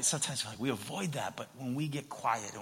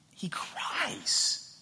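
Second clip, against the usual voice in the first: breathy, whispered wonder; hushed emphasis; 10 semitones higher than usual. Real, not acted. Preacher tells the congregation that Jesus cries, hushed and moved. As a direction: drop into an emotional whisper.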